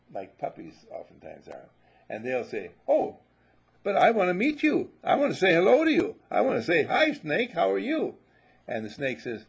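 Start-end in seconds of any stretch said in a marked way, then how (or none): 2.9-8.2 high, loud, gushing puppy voice; a naive puppy yelping hello, much higher and louder; eager puppy. Acted. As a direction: bubble over like a puppy desperate to make friends.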